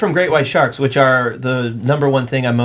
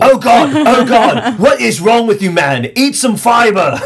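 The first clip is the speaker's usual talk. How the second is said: loud, high British indignation; hammed-up British shark scolding its meal; angry British accent; 9 semitones higher, 5 dB louder than usual. Shark complains about the human it bit, angry British accent. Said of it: "a light British angry accent".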